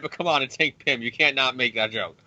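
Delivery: ordinary delivery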